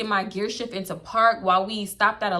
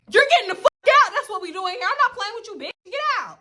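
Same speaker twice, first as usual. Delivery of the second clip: much higher, louder and fast, yelling her out; furious shout; 12 semitones higher, 4 dB louder than usual. Retold or imitated. Driver screaming at her friend to get out of the car, furious shout. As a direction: blow up and throw her out.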